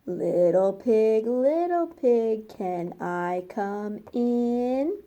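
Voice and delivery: slow, drawn-out wolf voice; the wolf sweetly asking the pig to open up, slowly. Acted. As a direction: coax the pig like a sly wolf. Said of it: "This is the wolf talking".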